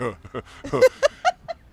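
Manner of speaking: high, loud mocking laugh in a put-on voice; imitating amused laugh. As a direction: laugh it off like a big man toying with you. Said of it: "He's like".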